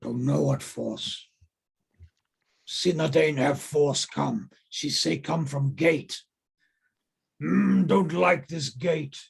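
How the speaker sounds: slow, broken-English ogre voice; growing indignant, drawn-out ogre speech. Acted. Game master voices ogres plotting revenge, slow ogre voice.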